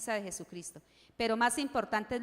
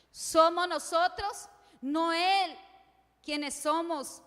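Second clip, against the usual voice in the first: louder and slow, each word weighed; emphatic, slow; 4 semitones higher, 5 dB louder, much slower than usual. Real, not acted. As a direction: press the point home word by word. Preacher hammers the key point.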